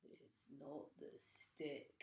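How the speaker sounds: slow, soft sly whisper. A narrator's reading. Mr Twit grinning horribly, whispering slowly to his wife. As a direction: whisper it with a nasty grin.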